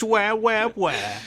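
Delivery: high whiny "where, where"; high mocking squeak; mock panic. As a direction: join in the mockery.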